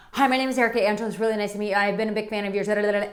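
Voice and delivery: retold nervous rapid-fire introduction; speaking so fast. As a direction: gabble it out in one breathless rush. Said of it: "and I spoke so fast".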